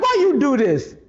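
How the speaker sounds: yelling at God. Acted. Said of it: "start yelling at God".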